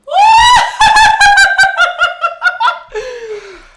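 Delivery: loud high laugh from the burn; pained shouting laugh. Real, not acted. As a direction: laugh out loud through the heat. Hot sauce burning, laughing out.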